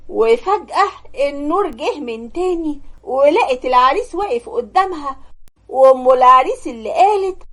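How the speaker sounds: ordinary delivery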